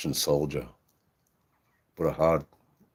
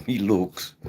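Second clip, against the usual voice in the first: ordinary talk, nothing marked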